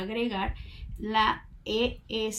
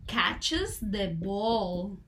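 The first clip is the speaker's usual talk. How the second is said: catches the ball, muy lento y marcado; very slow demo; much slower than usual. Shown as a demonstration. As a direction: estira catches the ball para que lo oigan. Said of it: "Entonces yo digo". (frase de ejemplo dicha muy despacio)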